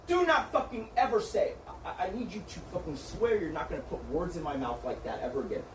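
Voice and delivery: ordinary talk, nothing marked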